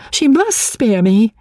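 high, slow, insistent cry; excited, high-pitched insistence; growing excitement. A narrator's reading. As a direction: cry it out high, leaning on the words. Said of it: "in growing excitement".

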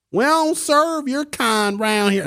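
high, slow mock Southern drawl; hammed-up high-pitched Southern accent. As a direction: play a hostile Southern local in a high voice. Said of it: "like they'd have a Southern accent".

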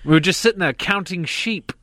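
high-pitched comic British voice; Slap Slapley. Acted. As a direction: pitch it way up, like a silly Brit. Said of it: "can we get Slap Slapley in here".

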